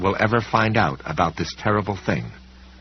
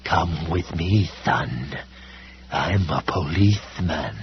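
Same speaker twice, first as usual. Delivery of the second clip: slow, low, sinister hush; menacing whisper; 3 semitones lower, slower than usual. A narrator's reading. Library wolf coaxes fleeing Sam, slow sinister hush. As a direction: coax the boy like a predator, slowly. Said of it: "is whispering".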